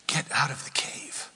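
whispered command. Acted. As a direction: breathe the order softly. God's quiet command.

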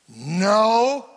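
loud, grudging no; sulky loud no. Acted. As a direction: bark a bitter no. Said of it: "Laban answering Jacob".

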